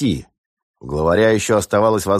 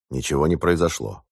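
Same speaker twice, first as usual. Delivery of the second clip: ordinary delivery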